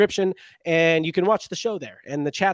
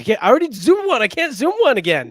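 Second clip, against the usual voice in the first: raised voice; 8 semitones higher, 7 dB louder than usual. Real, not acted. Arguing about the zoom.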